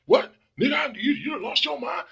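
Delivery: high, loud "you done lost your mind?"; wife shouts at husband's friend, imitated outrage; imitated outraged shout. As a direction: mimic the wife blowing up at her husband's friend. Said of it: "she be like".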